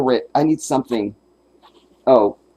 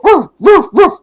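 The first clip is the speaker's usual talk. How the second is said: high, loud yapping barks; dog bark imitation; 12 dB louder than usual. Lassie in the sketch barks at the boy, high loud yaps. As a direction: bark like a little cartoon dog. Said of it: "And then Lassie go".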